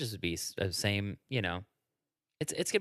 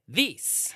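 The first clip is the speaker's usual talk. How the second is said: "This" drawn out and lifted high; stretched "This"; 7 semitones higher than usual. Retold or imitated. Host impression for a friend, "This" lifted. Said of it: "That's how Ryan Seacrest would say".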